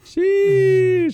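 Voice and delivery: very high-pitched, drawn-out reaction; exaggerated sheesh. Real, not acted. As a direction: squeal it out long and high.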